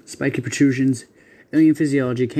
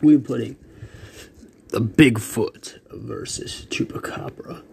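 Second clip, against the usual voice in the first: dramatic whisper; slower than usual. Acted. Announcing the battle matchup.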